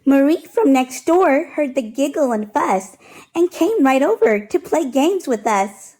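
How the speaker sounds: plain reading voice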